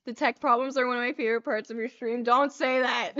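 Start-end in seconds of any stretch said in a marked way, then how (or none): 2.2-3.1 loud, quick objection; protesting. Real, not acted. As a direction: cut in with a protest. Protests at comment, first take.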